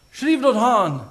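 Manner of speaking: voice of God. Retold or imitated. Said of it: "The Father said".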